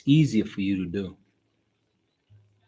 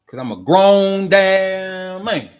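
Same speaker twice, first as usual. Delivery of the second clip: loud playful boast; 9 semitones higher, 9 dB louder, slower than usual. Real, not acted. Jokey boast to viewers.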